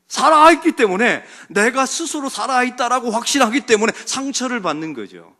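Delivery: loud, forceful sermon delivery; full-force preaching. Real, not acted. Preacher telling what free people do, full-force preaching. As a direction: drive each phrase home to the congregation.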